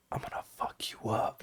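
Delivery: whispered threat; hushed, menacing gamer whisper; quiet trash talk. Acted. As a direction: whisper it so nobody next door hears.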